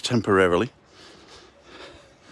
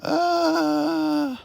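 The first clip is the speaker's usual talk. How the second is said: startled cry. Real, not acted. Sudden cry while walking on ledge.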